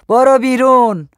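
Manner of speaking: loud, high, drawn-out yell; shouted dismissal. A narrator's reading. Gaddafi throwing the young woman out, long loud shout. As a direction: shout it slowly at full force. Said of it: "داد زد".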